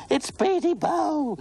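play-scream like a ghost is coming; horrified shriek. Acted. Mother shrieking to the playground children, high horrified shriek. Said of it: "shrieked Mother in a voice of horror".

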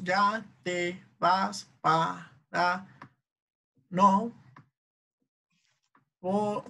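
slow, with a pause after each syllable; sílaba por sílaba. Shown as a demonstration. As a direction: count out the verse syllable by syllable. Teacher splitting a verse into syllables. (sílaba por sílaba, con pausas)

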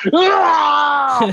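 a wrestler's yell flying off the stage; jumping-attack battle scream; battle cry. Retold or imitated. Battle yell mid flying elbow.

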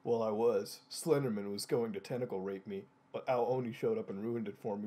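low, quiet sulk; sulky. Acted. Frowning nurse explains the ruined moment, sulky. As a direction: grumble it low and deflated. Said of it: "looked up at him with a giant frown".

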